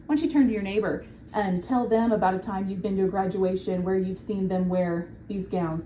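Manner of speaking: ordinary delivery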